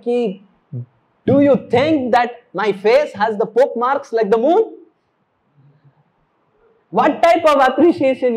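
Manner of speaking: protest, offended at the comparison; upset Radharani. Retold or imitated.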